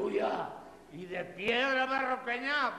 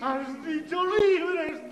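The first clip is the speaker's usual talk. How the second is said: excited; 5 semitones higher, 6 dB louder, slower than usual. Acted. Asombro ante la noticia de libertad.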